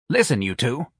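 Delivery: plain reading voice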